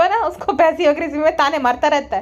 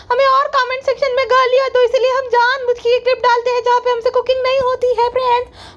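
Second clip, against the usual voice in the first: higher, sugary "friends" vlogger impression; mocking her sister-in-law's vlog voice, pitched higher; 8 semitones higher than usual. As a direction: ham up a fake-sweet influencer addressing fans.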